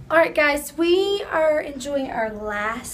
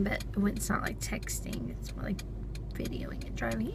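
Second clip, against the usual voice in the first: quiet, half-voiced aside; hushed aside; 7 semitones lower, 14 dB quieter than usual. Real, not acted. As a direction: drop to a near whisper.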